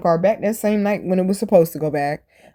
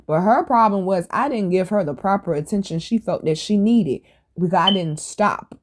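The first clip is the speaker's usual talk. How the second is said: ordinary talk, nothing marked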